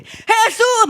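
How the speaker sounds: insistent shout to Jesus; crying out harder. Acted. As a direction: burst out over the shushing. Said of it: "más fuerte".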